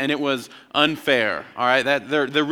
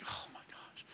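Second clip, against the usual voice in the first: very soft, voiceless whisper of disbelief; hushed mock horror; dismayed whisper; 27 dB quieter than usual. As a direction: whisper it like a crushed kid. Acting his dismayed childhood self.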